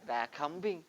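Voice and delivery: ordinary delivery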